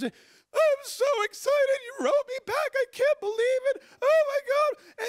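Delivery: hammed-up happy crying in a squeaky high voice; mock tearful bawling. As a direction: bawl like an overwhelmed fan, squealing high. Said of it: "She's crying".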